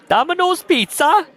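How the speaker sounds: high, loud mock Indian accent; thick Indian accent. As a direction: call out in a hammed-up Indian accent. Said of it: "called in a thick Indian accent".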